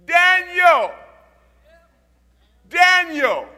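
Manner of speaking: king calling out. Retold or imitated. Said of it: "And he yells in there".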